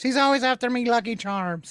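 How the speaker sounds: high-pitched mock Irish leprechaun accent; Irish accent, high and playful. Shown as a demonstration. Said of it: "say that in Irish voice".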